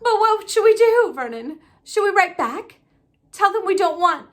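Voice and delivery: panicked pleading. Acted. Wife begging her husband what to do, high and frantic.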